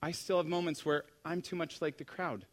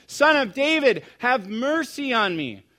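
shouting, yelling; 10 semitones higher, 12 dB louder than usual. Acted. Blind man calling out to passing Jesus, desperate shout. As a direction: yell it with all the effort you have. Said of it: "he shouts, he yells, the scripture says".

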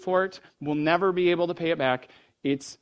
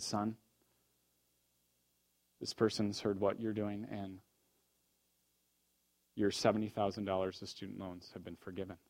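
soft and gentle; 7 semitones lower, 12 dB quieter than usual. Retold or imitated. President speaks gently to student.

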